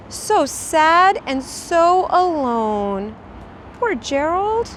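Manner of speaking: drawn-out sad storytelling; slow down, let it ache. A narrator's reading. Narrator dwelling on sadness.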